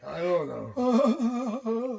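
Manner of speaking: slow, drawn-out ghostly moan. Real, not acted. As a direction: stretch it out like a ghost. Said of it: "Why do you sound like a freaking ghost".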